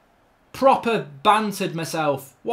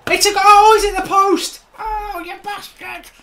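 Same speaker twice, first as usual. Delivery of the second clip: high goal shout turning to dismay; anguished excitement; 11 semitones higher, 8 dB louder than usual. Real, not acted. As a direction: celebrate too soon, then wail in disbelief. Near goal hits the post.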